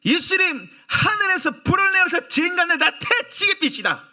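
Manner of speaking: high, loud, enraged outburst; hammed-up angry John begging Jesus; furious disciple. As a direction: call down fire in a hot rage. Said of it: "요한이 열이 받아가지고".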